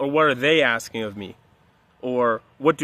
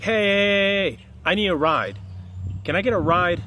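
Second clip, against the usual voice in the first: calling out for a ride; 4 semitones higher than usual. Retold or imitated. Stranger by the road hails the driver, mock calling out. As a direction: wave down the car, acting it out.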